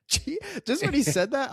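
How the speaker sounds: high laughing squeal through the words; breaking into laughter at the memory. Real, not acted. Telling a friend about the shocking line, laughing high.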